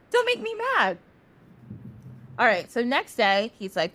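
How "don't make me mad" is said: mock threat. Eshu's playful angry threat.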